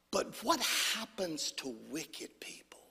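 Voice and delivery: slow whispered question; hushed slow question. Acted. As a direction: ask it low and ominous, barely voiced.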